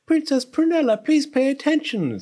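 high-pitched maid pleading for attention; scolding parlor maid. A narrator's reading. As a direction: scold the princess in a high servant's voice. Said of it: "said the palace parlor maid Penny".

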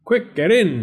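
high, loud command; urgent cry. A narrator's reading. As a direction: hurry them inside. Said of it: "he cried".

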